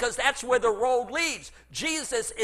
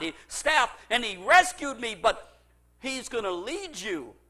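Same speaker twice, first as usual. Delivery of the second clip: ordinary delivery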